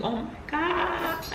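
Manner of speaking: high drawn-out held-back scream; mock scream. New mom acting out her screaming in labor, held-back high scream. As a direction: stretch it into a high, thin wail. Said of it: "I started screaming".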